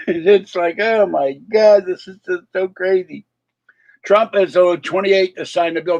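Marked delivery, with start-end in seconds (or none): 0.1-3.3 high, amused disbelief after a laugh. Real, not acted. Vlogger reacting to crazy political news, laughing disbelief. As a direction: giggle into the words, pitched up. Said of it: "I keep laughing because".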